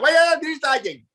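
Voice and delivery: exaggerated thick Spanish accent, high-pitched; horrible old accent. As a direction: imitate your own garbled beginner English in a Spanish accent. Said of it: "back then my accent was horrible".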